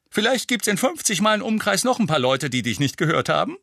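high, loud, cheeky jibe; beaming teasing. A narrator's reading. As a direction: tease him brightly through a grin. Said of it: "ebenfalls strahlend". (strahlend frech und laut)

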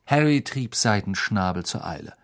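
ordinary delivery

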